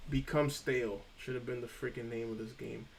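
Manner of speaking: ordinary talk, nothing marked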